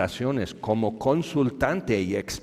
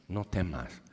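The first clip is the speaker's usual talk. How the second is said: gentle reassurance; 3 semitones lower, 7 dB quieter than usual. Acted. Jesus gently reassures Jairo.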